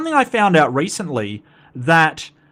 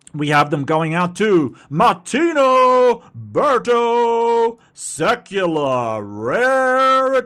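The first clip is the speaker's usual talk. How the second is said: slow, higher hyped arena announcer; fighting game announcer; 8 semitones higher, 4 dB louder, slower than usual. Acted. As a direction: stretch the names like a ring announcer. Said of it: "let me get my announcer voice".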